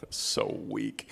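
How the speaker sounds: a disappointed whisper at the weak reply; unimpressed whisper. Real, not acted. Host mocks the Marines' weak answer, whispering. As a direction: hush it out, let down. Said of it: "I will demonstrate for you".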